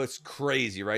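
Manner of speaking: ordinary talk, nothing marked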